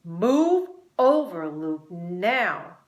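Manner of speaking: loud, slow angry order. A narrator's reading. Pablo angrily orders Luke to move. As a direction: snap the command out. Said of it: "Pablo said angrily".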